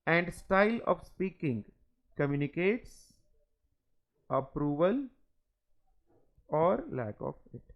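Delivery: each word spaced out for students to copy; slow dictation. Acted. Teacher dictating notes to the class, very slow. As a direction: read it slowly so the class can write.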